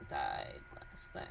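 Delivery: whispered to herself, slow and low. Real, not acted. Vlogger rereading an email, whispering the phrase to herself.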